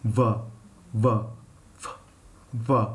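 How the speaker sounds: "V" buzzed low, voice on, teeth on lip; voiced v. Shown as a demonstration. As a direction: hum through "v" with the voice on. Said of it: "Now voice lao".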